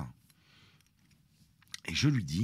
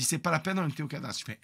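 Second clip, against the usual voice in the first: normal talking voice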